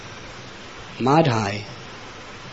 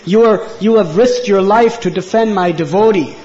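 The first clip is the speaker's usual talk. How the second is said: louder, higher, emphatic praise; fervent declaration; 6 semitones higher, 8 dB louder than usual. A narrator's reading. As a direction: proclaim it with mounting fervour. Quoted Lord praises devotee's sacrifice.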